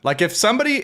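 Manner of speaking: ordinary talk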